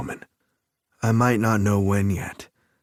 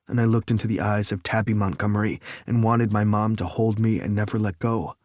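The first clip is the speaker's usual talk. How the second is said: ordinary delivery, nothing marked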